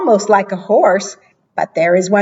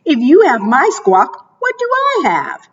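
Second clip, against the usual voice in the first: worried parrot; 7 semitones higher than usual. Acted. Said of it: "said Parrot".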